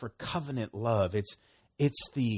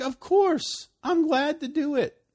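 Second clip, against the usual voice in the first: much higher and louder; eager yes; 7 dB louder than usual. Acted. Friend asked for help, much higher, eager reply. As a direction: burst out gladly. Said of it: "You're like".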